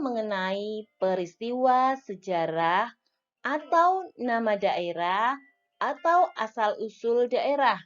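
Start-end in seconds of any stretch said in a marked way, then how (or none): none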